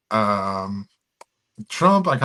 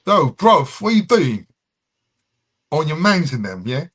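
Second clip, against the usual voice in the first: high, loud London street voice; London accent; 6 semitones higher, 7 dB louder than usual. Acted. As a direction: shout it like a London roadman. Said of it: "That's how they talk like that".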